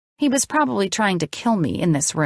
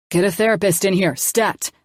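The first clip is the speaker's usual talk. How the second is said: ordered. A narrator's reading. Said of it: "the doctor ordered".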